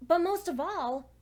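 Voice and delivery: anxious questions piling up toward a cry; rising distress. Acted. Alone in an empty town, her questions climb toward a frightened cry. Asking where everyone went.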